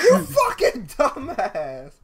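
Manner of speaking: loud, slow, high insult; yelling. Real, not acted. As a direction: yell it, dragging each word. Yelling at friend.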